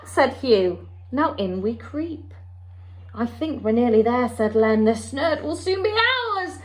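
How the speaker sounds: lively storybook read-aloud with character voices; story time voice for a children's picture book. Acted. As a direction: read it to a class of little ones, bright and expressive.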